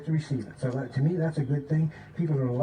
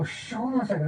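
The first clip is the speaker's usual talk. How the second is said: higher-pitched shush to the group; shushing friends; 7 semitones higher than usual. Acted. Listener quieting friends to hear him, urgent shushing. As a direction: hush them so you can listen. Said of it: "they'll go to their friends".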